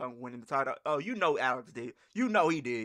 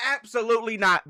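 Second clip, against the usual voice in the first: loud, higher "Absolutely" hammered home; loud refusal; 6 semitones higher, 8 dB louder than usual. Real, not acted. Reactor refusing the jail joke, loud and emphatic. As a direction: shut it down hard and loud. Reactor rejecting the skit loudly.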